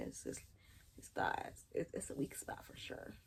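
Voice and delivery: whispered secret aside. Real, not acted. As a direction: breathe it like a secret. Sharing a secret tip.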